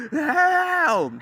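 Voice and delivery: huge, high, drawn-out scream; gangster scream. Retold or imitated. Father imitates the desperate cry his wife finally heard, screaming. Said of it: "like, a gangster one".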